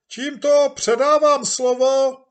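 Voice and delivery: very high, loud strained shout; chairman shouting. Acted. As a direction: shout over the noise to hand over the floor. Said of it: "zakřičel a rozpřáhl ruce". (předseda křičí přes hluk)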